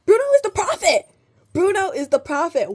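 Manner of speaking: fervent rant; impassioned declaration. Real, not acted. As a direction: proclaim it like a sermon. Passionate rant about prophet.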